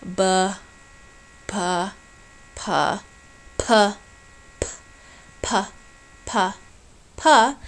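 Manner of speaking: "B" voiced at first, then a breathy "P" with a puff of air; sliding from B to P. Shown as a demonstration. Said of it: "so I went B".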